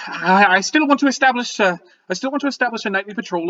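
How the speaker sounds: high, stammering official; high, flustered gnome voice; flustered gnome leader. Acted. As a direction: rattle off orders in a high, fussy voice. Flustered gnome leader giving orders.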